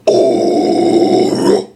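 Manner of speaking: airy scream with no tone; scream without voice. Retold or imitated. Imitating the band's toneless scream. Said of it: "Asking [name], it's like".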